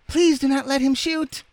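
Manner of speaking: loud, very high squeaky plea; French doll crying out. A narrator's reading. Doll begging that the gun stay silent, crying out. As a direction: plead in a piping falsetto. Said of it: "cried the French dolly".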